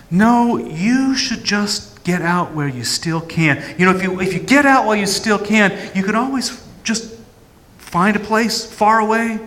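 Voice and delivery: high, loud, mocking scorn; accusing inner voice mocking the would-be Messiah; sneering accuser. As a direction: jeer at him with contempt. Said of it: "the accuser voice".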